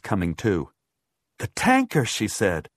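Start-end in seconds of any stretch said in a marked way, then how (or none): 1.4-2.0 sudden high-pitched voice; alarmed realization. A narrator's reading. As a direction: remember it with a jolt of alarm. She suddenly remembers, alarmed.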